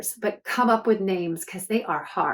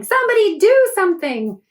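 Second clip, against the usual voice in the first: much higher, louder yell; a passerby yelling in panic; 11 semitones higher, 9 dB louder than usual. A narrator's reading. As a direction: yell for help while running by. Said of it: "somebody runs by yelling".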